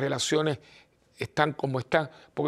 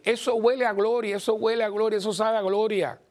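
exalted; 7 semitones higher than usual. Real, not acted. Preaching climax.

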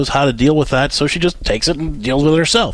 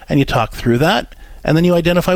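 ordinary talk

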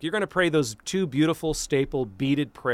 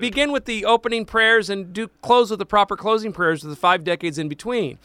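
lightly put-on Portuguese accent, pitched up; 7 semitones higher, 3 dB louder than usual. Priest explaining the rosary to a laughing co-host, light mock Portuguese accent. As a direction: keep the comic Portuguese accent going at a higher pitch. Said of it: "That's my Portuguese accent".

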